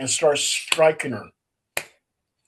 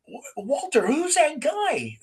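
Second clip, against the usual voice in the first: puzzled friend; 10 semitones higher than usual. Retold or imitated. Guest asking Walter about the stranger, high bewildered voice. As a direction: ask it like a baffled guest. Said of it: "they said".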